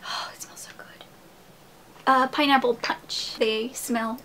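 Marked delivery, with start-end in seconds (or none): 0.0-1.0 very soft, whispered swoon over a scent; hushed delight. Real, not acted. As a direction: savor it in a whisper. Smelling a candle, delighted.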